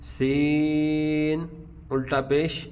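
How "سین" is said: سین held slowly, light with a whistling s; thin seen. Shown as a demonstration. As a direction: stretch سین thin and hissing. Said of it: "sound باریک ہوگی".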